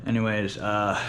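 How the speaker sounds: normal talking voice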